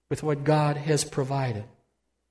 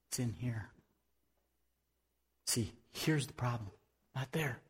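hushed whisper, much softer; 3 semitones lower, 12 dB quieter than usual. Real, not acted. Preacher to congregation, pointing at the heart, whispered. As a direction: lean in and breathe it.

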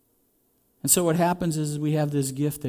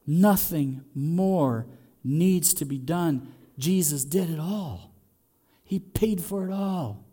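slow, deliberate; slower than usual. Real, not acted. Slow emphatic conclusion.

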